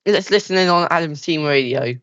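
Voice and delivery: fast live race commentary; motorsport commentator. Acted. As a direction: call the lap like Sky F1. S0 play-by-play of lap.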